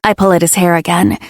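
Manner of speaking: usual speaking voice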